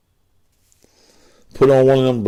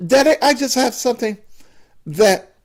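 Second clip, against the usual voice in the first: much higher, fast son's voice; a son answering his father in a much higher, quick voice; 11 semitones higher, faster than usual. Acted. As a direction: rush it out high, like the nervous son. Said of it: "the way you talk".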